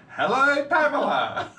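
calling out a greeting down the street. Retold or imitated. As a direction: call out to someone walking away. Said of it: "just call out".

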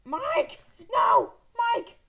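high, loud cries of panic; alarmed shout. Pretend. Player yelling to teammate Mike in danger, alarmed shout.

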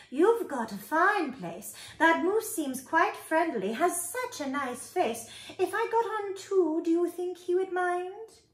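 high, small creature voice; small spider voice. A narrator's reading. Tree spider asks to come aboard, small voice. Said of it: "the small voice of a tree spider".

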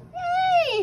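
very high, loud shriek. A narrator's reading. Flap shrieking as he falls through the dark. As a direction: shriek in panic. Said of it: "shrieked Flap".

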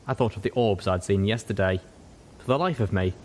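plain reading voice

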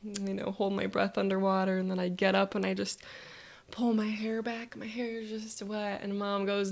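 ordinary talk

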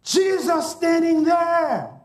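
slow, high preaching shout; climactic shout. Real, not acted. Preacher telling the congregation who Mary saw, climactic shout. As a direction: proclaim it at full pitch, every word stretched.

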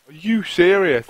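loud, slow, drawn-out disbelief; slow outraged shout at the game; exasperated shout. Real, not acted. Outraged at the game's loot.

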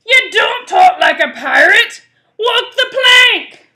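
loud, high outraged pirate yell; bellowing pirate. Acted. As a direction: order a failed recruit off the ship, bellowing. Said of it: "cried Pete".

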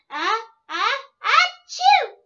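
sneeze buildup. Pretend. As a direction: build the sneeze then burst. Character sneezes.

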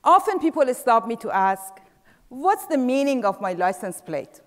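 ordinary talk

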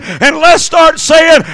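ordinary delivery

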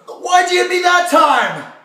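high, loud panicked yelling; yelling in pain. Acted. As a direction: shout in panic at the attacker. Hit again, yelling.

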